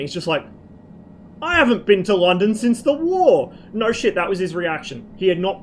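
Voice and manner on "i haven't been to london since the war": put-on old fella. Acting out the old fella's words.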